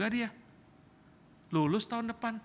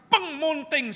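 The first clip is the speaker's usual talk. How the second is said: crash noise; 9 semitones higher, 8 dB louder, slower than usual. Retold or imitated. Suara mobil terbanting.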